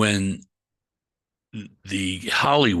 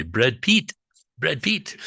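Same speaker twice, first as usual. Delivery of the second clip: put-on Brad Pitt in a light Tibetan accent, rising high; 6 semitones higher, slower than usual. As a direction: imitate Tibetan friends saying Brad Pitt. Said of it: "as our Tibetan friends would say".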